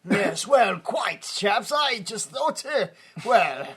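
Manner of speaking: faltering old-chap British impression; mock British accent. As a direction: dither politely in a put-on British accent. Co-host mimics stammering British character.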